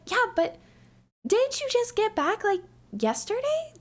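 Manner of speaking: high, incredulous questioning in imitation; surprised boss. Boss querying employee just back from vacation, imitated surprise. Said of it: "She was so surprised".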